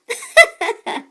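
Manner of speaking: high, bright burst of laughter; hearty laugh. Acted. The mouse family laughing at the poster, hearty laugh. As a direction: laugh at the silly slogan. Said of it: "a hearty laugh".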